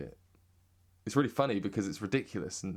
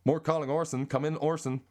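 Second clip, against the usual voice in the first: high, put-on American accent; 7 semitones higher than usual. Back to the American catchphrase.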